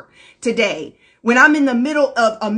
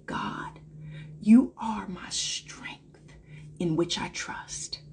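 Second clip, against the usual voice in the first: whispered prayer; 5 semitones lower, 10 dB quieter, slower than usual. Shown as a demonstration. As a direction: whisper the mantra, slow and low. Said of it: "a mantra and an affirmation I speak to myself".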